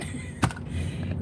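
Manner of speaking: soft breath-heavy laugh; quiet, helpless laughing. Real, not acted. Amused by own impression of the customer, soft laugh.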